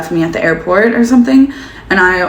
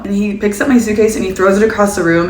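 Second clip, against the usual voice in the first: ordinary talk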